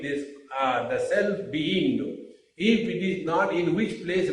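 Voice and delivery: usual speaking voice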